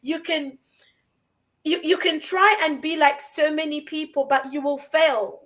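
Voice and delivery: normal talking voice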